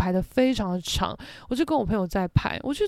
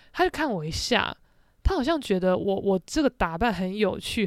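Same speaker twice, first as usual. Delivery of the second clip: normal talking voice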